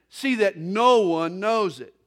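loud, slow, firm warning; stern warning. Acted. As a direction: lay down the warning slowly and loudly. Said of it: "Jesus sternly warned them".

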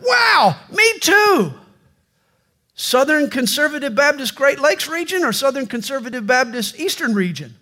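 high thrilled exclamation; delighted, pitch it way up; excited. Acted. Rescuer thrilled they share a faith, high excited cry.

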